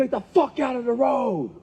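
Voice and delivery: high mock yell, no real volume; imitated road-rage yell. Imitating people yelling at him from a passing car, mock road-rage yell. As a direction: holler it like a passing driver.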